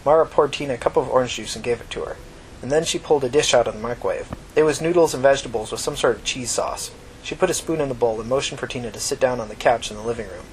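ordinary delivery

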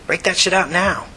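high, eager push; pushy demand. Acted. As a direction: hurry them along. Joe demands the liquor.

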